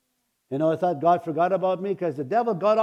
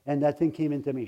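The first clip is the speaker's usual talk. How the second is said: normal talking voice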